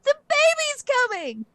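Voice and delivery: very high, loud panicked wail; screaming in fake labor. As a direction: cry out so the whole crowd turns. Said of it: "where Lamara screams".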